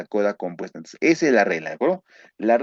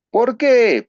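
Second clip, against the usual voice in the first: rhetorical question; 12 semitones higher, 7 dB louder than usual. Shown as a demonstration. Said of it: "teacher raises rhetorical question".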